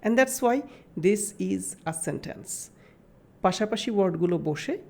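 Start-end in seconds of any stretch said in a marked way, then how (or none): 0.0-2.7 drawn out, word by word; slow emphatic teacher conclusion; very slow summary. Shown as a demonstration. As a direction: spell it out slowly for the class. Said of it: "summing up for the class".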